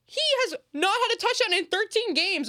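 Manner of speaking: loud and high, fed up; loud fed-up rant; exasperated rant. Real, not acted. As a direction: let the outrage pour out. Ranting at fans.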